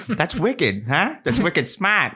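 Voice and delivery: exaggerated thick Boston accent. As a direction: imitate a Boston ad guy. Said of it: "their super original thick Boston accents".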